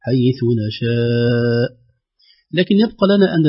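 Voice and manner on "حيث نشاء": حيث نشاء with the madd held long before the hamza; حيث نشاء drawn out very slowly. Shown as a demonstration. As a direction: stretch نشاء slowly, a light push on the hamza. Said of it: "يصل إلى خمس أو ست عند الوقف".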